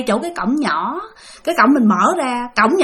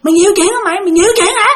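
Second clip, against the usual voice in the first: acted-out cursing, rising higher each time; retold scolding; 6 semitones higher than usual. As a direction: imitate an angry man's taunting accusation, high-pitched. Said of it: "Ông chửi Thi vậy đó".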